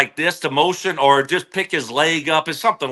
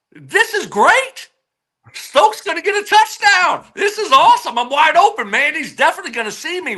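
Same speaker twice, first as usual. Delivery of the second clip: much higher, giddy inner voice; high-pitched gleeful self-talk, slowing on great; retold excitement; 10 semitones higher than usual. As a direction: relive the rush of being wide open. Retold thrill of being wide open.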